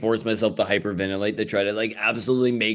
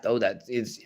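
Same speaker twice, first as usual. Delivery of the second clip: usual speaking voice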